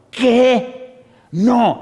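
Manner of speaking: loud, high outburst of disbelief; shocked outcry. Retold or imitated. Woman told to forgive her father, shocked refusal.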